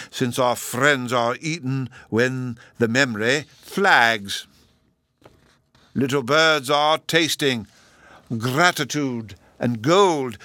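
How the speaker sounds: high, slow, drawn-out recital; professor reciting verse. A narrator's reading. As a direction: declaim slowly, with little ums and chuckles.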